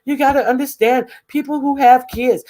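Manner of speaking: high, put-on busy mom voice; mock self-important. Busy mother mimicked excusing herself to others, mocking.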